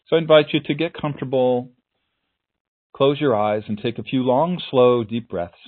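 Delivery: calm, unhurried meditation voice; guided meditation. Acted.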